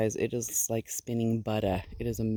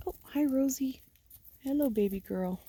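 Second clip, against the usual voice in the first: higher, breathy pet talk; high whispered greeting; 11 semitones higher, 4 dB quieter than usual. Real, not acted. Greeting Rosie the ewe in a high, tender whisper.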